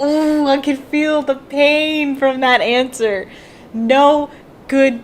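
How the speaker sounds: loud, high, slow wincing cry; drawn-out, appalled wail; pained outcry. Real, not acted. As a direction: cry out in sympathetic agony. I can feel the pain.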